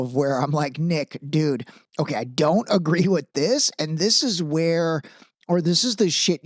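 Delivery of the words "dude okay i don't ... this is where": normal talking voice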